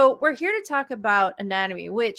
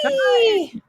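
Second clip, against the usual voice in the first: high, loud drawn-out whee; gleeful whee; 14 semitones higher, 6 dB louder than usual. Real, not acted. Speaker cheering once the host is gone, gleeful whee. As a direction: squeal a long whee of release.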